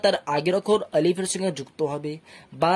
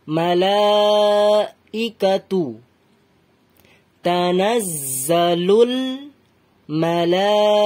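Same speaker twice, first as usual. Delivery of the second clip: slow Quran recitation with long held vowels; drawn-out, measured teaching recitation; broken-up recitation; much slower than usual. Shown as a demonstration. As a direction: recite it piece by piece, stretching each long vowel. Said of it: "ভেঙে, ভেঙে, ভেঙে বলছি".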